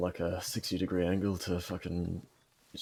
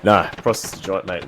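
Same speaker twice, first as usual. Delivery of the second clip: drawn-out, loud dismissive scoff; 10 dB louder than usual. Real, not acted. Gamer mocking Australian army recruitment, loud scoff. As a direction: scoff it at full volume, slowly.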